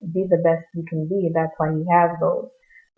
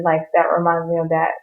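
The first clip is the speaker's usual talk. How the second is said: normal talking voice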